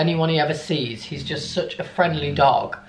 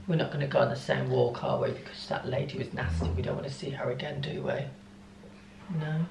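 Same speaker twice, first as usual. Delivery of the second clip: soft, much quieter pet talk; cooing to the dog; 2 semitones lower, 12 dB quieter, faster than usual. Real, not acted. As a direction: whisper your love to the dog. Whispering to the dog.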